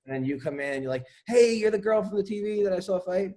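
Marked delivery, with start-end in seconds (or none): 1.3-3.3 higher-pitched starstruck patient; excited fan. Acted. Patient recognizing the fighter, excited. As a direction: play a patient spotting a famous fighter.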